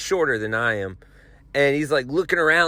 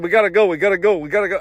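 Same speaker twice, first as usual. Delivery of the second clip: pushy urging; 4 semitones higher, 3 dB louder, much faster than usual. Retold or imitated. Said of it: "pushing, pushing".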